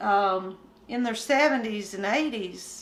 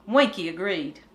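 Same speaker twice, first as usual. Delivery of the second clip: ordinary delivery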